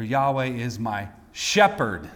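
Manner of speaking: "shepherd" set apart after a pause and emphasized; stress on SHEPHERD. Shown as a demonstration. Preacher showing ways to read the verse, stress on "shepherd". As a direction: hold back after "my", then land on "shepherd". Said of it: "Read it all that way".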